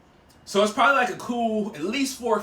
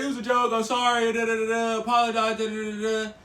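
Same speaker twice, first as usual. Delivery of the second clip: monotone retold apology running into filler; dismissive mimicry. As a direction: rattle off a mock apology as blah-blah.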